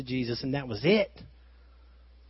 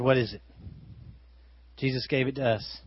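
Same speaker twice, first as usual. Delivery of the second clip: ordinary talk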